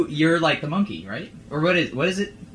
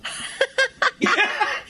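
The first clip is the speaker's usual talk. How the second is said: big cackle. Real, not acted. Host cackles at intro.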